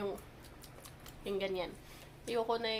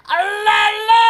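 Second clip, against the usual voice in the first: excited shout; 5 semitones higher, 13 dB louder, slower than usual. Real, not acted. Cheering child's photo.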